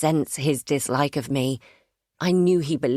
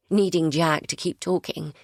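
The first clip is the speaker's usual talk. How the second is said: ordinary delivery, nothing marked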